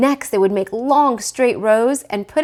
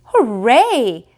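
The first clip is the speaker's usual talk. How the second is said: excited cheer; 3 semitones higher, 7 dB louder than usual. Acted. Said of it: "cried the quadruplets".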